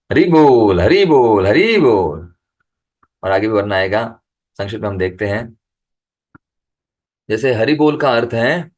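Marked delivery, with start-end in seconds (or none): 0.1-2.3 welcoming call. Shown as a demonstration. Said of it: "स्वागत भी किया जाता है इस हरि बोल के द्वारा".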